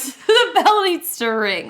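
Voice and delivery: teacher wishing the day over; desperate. Retold or imitated. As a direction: beg for the bell, slowly. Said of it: "I'm like".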